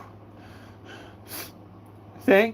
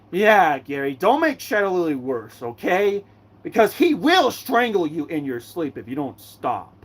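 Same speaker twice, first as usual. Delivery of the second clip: ordinary delivery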